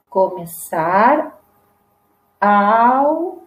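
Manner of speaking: começar, almoçar in stretched, separate syllables; som de S. Shown as a demonstration. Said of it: "som de S". (começar, almoçar sílaba por sílaba, com som de S)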